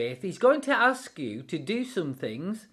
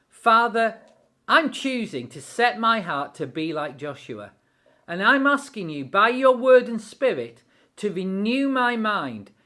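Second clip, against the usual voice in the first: fervent, louder spoken prayer; heartfelt closing prayer; 5 dB louder than usual. Acted. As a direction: call out to God in earnest. Leads a prayer.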